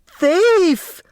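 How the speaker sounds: piercing high shriek; high shrieked accusation. A narrator's reading. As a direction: shriek it like you've caught a thief. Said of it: "the secretary shrieked".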